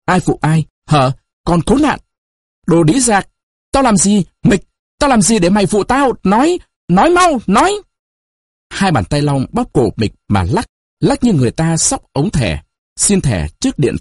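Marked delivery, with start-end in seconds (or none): 0.1-7.7 high, urgent angry demands; furious questioning in short, high bursts; harsh interrogation. A narrator's reading. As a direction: climb in pitch as you demand an answer.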